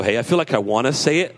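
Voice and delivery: ordinary talk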